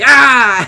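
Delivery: a sudden high, loud yelled "Ah!"; dad's loud yell. Retold or imitated. Imitating dad posing as the Kool-Aid Man, loud yell. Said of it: "and he was like".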